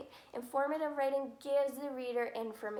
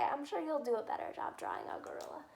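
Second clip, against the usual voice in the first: whisper; 4 dB quieter, faster than usual. Real, not acted. Self-mocking aside to kids.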